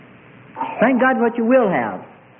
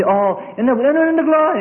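joyful believer; 7 semitones higher than usual. Retold or imitated. Preacher voicing a restored worshipper, high and joyful. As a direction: lift it up in glory.

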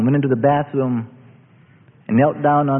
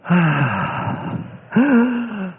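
sigh of relief; 5 semitones higher than usual. Real, not acted. Relief as son breathes again.

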